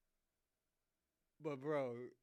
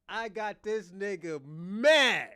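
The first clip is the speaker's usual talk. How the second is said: gloating drawl; 8 dB louder, much slower than usual. Real, not acted.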